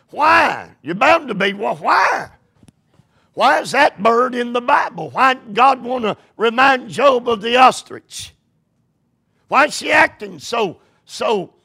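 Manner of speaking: high, loud fervent questions; preaching. Acted. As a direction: hammer the questions home. Preaching at full force.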